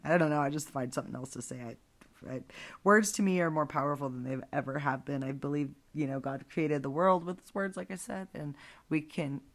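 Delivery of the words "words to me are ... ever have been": ordinary talk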